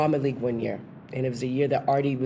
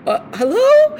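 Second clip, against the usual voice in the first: nervous surprise; 8 dB louder, slower than usual. Retold or imitated. Stunned on phone with Chita.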